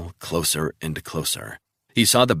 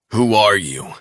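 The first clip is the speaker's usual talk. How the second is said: seething; 3 semitones higher, 6 dB louder than usual. A narrator's reading. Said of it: "he seethed".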